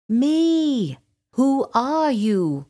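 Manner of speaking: high, loud indignant dragon; insistent young dragon. A narrator's reading. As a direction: call up to an unseen stranger, high and drawn out. Lost dragon challenges hooting owl.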